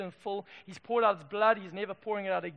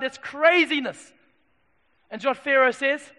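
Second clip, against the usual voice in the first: loud, raised-pitch sermon storytelling; impassioned preaching; 5 semitones higher, 9 dB louder than usual. Acted. Preacher telling the crowd of plagues on Egypt, impassioned. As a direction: preach the wonders at full force.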